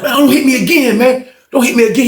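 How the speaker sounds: high frightened begging; scared pleading. Retold or imitated. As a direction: beg him not to hit you. Begging not to be hit.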